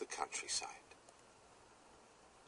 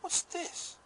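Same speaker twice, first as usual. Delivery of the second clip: much higher, startled cry of discovery; surprised exclamation. Acted. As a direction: gasp it out as a sudden find. Boy finds a strange shoebox.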